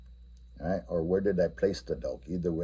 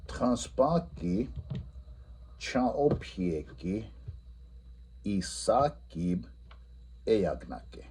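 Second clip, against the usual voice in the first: very slow, each Lakota word stretched; teacher giving the Lakota answer; slow model answer; 2 semitones higher, 5 dB quieter, much slower than usual. Shown as a demonstration.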